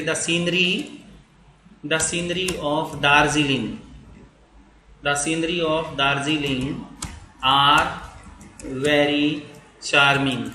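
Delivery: each word slow and spaced, with long pauses; slow dictation. Shown as a demonstration. Teacher dictating a sentence, slow and spaced. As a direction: spell it out for students to write.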